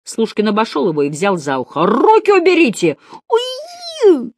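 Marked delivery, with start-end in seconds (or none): 1.9-3.0 high, loud cry of protest; pained, indignant schoolboy shout; yelling. A narrator's reading. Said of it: "заорал Градусов". 3.3-4.3 long, piercingly high yelp; drawn-out acted yowl of pain; high squeal. A narrator's reading. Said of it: "заорал Градусов".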